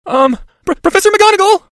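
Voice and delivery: high, loud, halting call. Acted. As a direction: force it out loudly, stumbling on the name. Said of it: "raised his voice further, as though it were hard".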